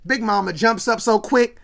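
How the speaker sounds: ordinary talk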